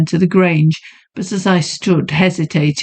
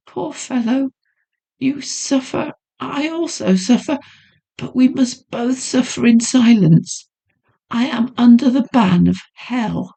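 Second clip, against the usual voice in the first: put-on pitying young woman; 6 semitones higher than usual. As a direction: comfort him gently. Heroine pities the narrator.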